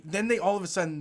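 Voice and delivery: normal talking voice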